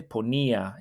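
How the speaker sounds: ponía with the weight on the í, po-NÍ-a; stress on the í. Shown as a demonstration. Teacher showing where the stress falls in ponía. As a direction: lean on the í of ponía. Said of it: "Notice where I'm putting the stress".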